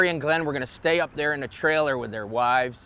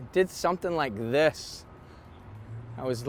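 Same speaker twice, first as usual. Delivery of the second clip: usual speaking voice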